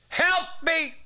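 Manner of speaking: high, strained cry for help; reenacted plea for rescue; desperate plea. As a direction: call out like a drowning man. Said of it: "Guess what I said?".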